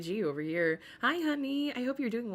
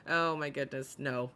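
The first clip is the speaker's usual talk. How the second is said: ordinary talk, nothing marked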